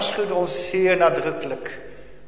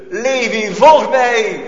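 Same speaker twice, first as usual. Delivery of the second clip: loud, higher calling of Levi by name; voice of love; 6 semitones higher, 10 dB louder, slower than usual. Acted. Jesus calling Levi from the tax booth, loud loving call. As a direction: call him out of his counting. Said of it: "dan hoor je de stem van de liefde".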